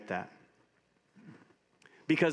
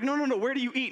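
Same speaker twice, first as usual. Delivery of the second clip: incredulous chef; 14 semitones higher, faster than usual. Retold or imitated. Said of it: "They're like".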